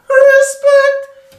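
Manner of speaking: high belted respect through the nose. Retold or imitated. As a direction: belt respect nasally. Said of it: "C'est pas avec la voix de tête".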